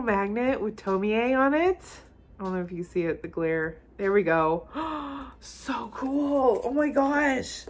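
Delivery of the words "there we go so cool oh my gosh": ordinary delivery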